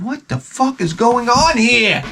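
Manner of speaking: high, slow exasperation; exasperated disbelief. Retold or imitated. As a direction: drag it out in disbelief. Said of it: "I said".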